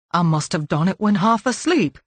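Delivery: plain reading voice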